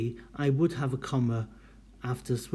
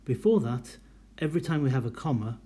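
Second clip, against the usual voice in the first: ordinary talk, nothing marked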